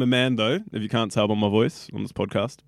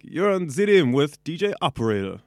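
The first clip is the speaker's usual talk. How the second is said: radio DJ; 4 semitones higher, 5 dB louder than usual. Pretend. Demos a radio station ident.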